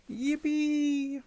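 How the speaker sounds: high, held mock kiddie yippee; put-on squeal of glee; high-pitched cheer. Same kid act cheering, high.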